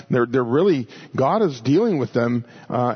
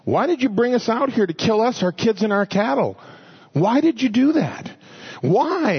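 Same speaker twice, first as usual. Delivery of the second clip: whining; 7 semitones higher than usual. Retold or imitated. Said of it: "their answer is".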